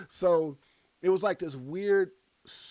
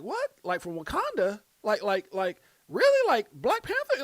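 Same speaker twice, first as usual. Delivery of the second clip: incredulous, climbing high and louder; mock disbelief; 7 semitones higher, 6 dB louder than usual. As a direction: act out baffled amazement, voice shooting up. Mock disbelief at wife's claim.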